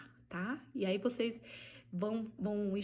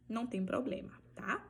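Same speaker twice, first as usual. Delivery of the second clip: ordinary talk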